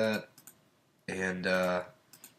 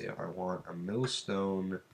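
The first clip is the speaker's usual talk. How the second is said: ordinary talk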